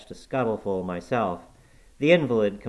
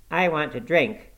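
mildly fretful, higher and louder; fretful exclamation; 7 semitones higher, 5 dB louder than usual. A narrator's reading. Sick boy demanding a drink from his visitors, fretful. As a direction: exclaim it like a querulous invalid, pitched up. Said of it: "he exclaimed fretfully".

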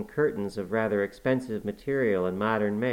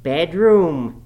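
loud, high mocking echo of Bedroom; mockery; 7 semitones higher, 11 dB louder than usual. A narrator's reading. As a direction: throw the word back scornfully. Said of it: "he repeated in a tone of mockery".

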